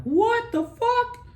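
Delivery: very high, slow, stretched disbelief; stunned what the fuck. Retold or imitated. Boy seeing dad's parachute open at last, stunned. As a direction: drag it out in shocked relief. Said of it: "poof, I see a little parachute".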